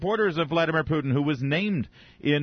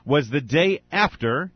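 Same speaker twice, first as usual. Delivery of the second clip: ordinary delivery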